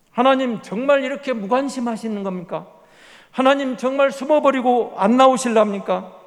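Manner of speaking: loud lament. Acted. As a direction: cry out in anguish, demanding an answer. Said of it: "여호와를 부르고 나서".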